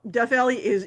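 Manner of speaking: normal talking voice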